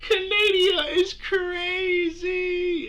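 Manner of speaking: slow, high, wheezing laugh; laughing hard. Real, not acted. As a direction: crack up while saying it. Cracking up over clip.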